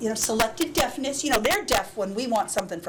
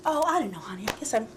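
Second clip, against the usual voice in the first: soft unvoiced whisper, much softer; 6 dB quieter, faster than usual. Mom still whispering to her son, soft whisper. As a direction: keep it hushed and breathy-free. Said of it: "Why are you whispering?".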